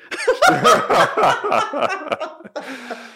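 high, loud laugh from a script; demonstrated script-cue laugh. Shown as a demonstration. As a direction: burst into a big laugh on cue. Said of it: "Laugh here".

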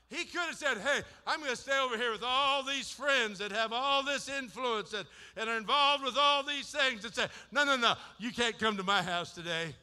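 high, loud revival preaching that climbs into shouts; fiery preaching. Acted. Preacher to the congregation, full-force shouting sermon. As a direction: preach it hard and lift the room.

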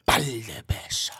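slow, forceful stage whisper; drawn-out whisper. Real, not acted. Guest dodging the host's price question, slow whisper. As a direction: whisper it slowly, like a secret. Coyly repeats price when asked again.

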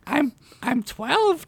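put-on slow, high child voice; hesitant little girl. A narrator's reading. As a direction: stammer it out like a small girl. Little girl answers stern Fred.

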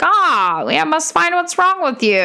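put-on high, old-fashioned doctor voice; old-timey voice. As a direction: play a stuffy vintage doctor. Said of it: "I love doing my old-timey voice".

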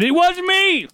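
high-pitched cry of protest; shrill-high theatrical denial; mock shout.

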